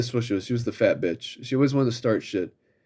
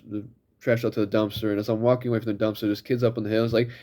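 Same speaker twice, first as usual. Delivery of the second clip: ordinary talk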